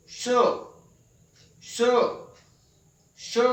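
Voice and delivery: isolated, separated श syllables, tongue up; palatal sha. Shown as a demonstration. Teacher showing how the first sha is made, careful pronunciation. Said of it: "इसका उच्चारण होता है इस तरह से".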